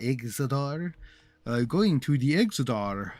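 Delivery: slow, hammed-up Naru accent on Exodar; lightly put-on Naru accent, drawn out; terrible Naru accent. Shown as a demonstration. Streamer tries a bad Naru accent for chat, slow and mock. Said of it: "That's a terrible Naru accent".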